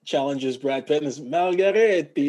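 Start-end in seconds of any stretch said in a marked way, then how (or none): 1.2-2.3 loud, drawn-out fake Italian; terrible Italian accent. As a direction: butcher the Italian like an American. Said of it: "the worst Italian accent ever".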